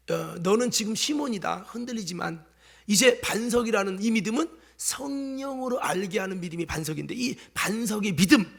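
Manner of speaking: ordinary talk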